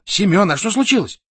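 high, anxious question; alarmed. A narrator's reading. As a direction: ask with a touch of alarm. Frightened boss turns to subordinate. (встревоженно)

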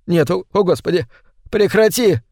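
high, hurried begging on the edge of tears; desperate plea. A narrator's reading. Terrified man begging before he bursts into tears, desperate plea. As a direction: beg to stop, pitch climbing. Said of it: "Он разрыдался".

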